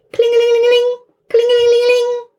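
high, piercing ringing voice; phone ring. Acted. A ringing telephone waking the mosquito, high and shrill.

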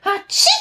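big high sneeze; sneezing. Acted. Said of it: "Und ich niese in die Armbeuge". (lautes Niesen)

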